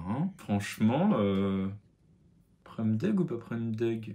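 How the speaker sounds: ordinary talk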